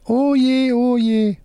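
very high puppet squeal of dismay; fretting hand puppet. A narrator's reading. Left hand sees the spit fall, high dismayed puppet voice. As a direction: fret in a tiny high puppet voice. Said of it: "Wolfram mit der linken Hand".

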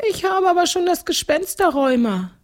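high-pitched ghostly complaint; wailing ghost. A narrator's reading. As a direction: moan about it in a very high ghost voice. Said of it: "jammerte Tatzenmutz". (jammerndes Gespenst, hohe Stimme)

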